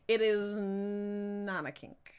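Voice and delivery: slow, deliberate denial; drawn-out firm denial; slow insistence. Real, not acted. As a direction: spell it out word by word.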